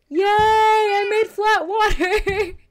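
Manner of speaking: drawn-out, half-crying cry of triumph; elated wail. Real, not acted. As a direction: stretch it out like a happy sob. Celebrates after struggling with water.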